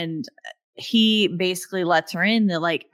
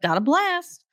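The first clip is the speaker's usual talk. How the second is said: hurried excuse; 6 semitones higher, faster than usual. Retold or imitated. Luke hurriedly excusing himself.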